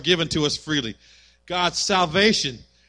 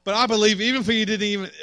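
ordinary talk, nothing marked

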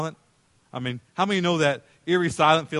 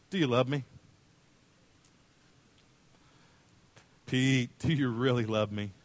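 lower, unhurried tender question; gentle questioning; 4 semitones lower, 4 dB quieter than usual. Acted. Jesus asks Peter if he loves him, gently. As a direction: ask it softly and sincerely.

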